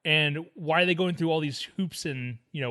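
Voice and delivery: ordinary talk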